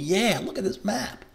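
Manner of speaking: high, slow, gleeful mock snow fan; excited snow lover. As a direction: play an overjoyed snow lover eyeing the map. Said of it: "you would be freaking out".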